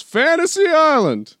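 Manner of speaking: high, loud, drawn-out yell; hammed-up scream, stretched out; imitated trailer shout. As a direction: holler it like an excited movie character. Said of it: "Does he say that in the trailer".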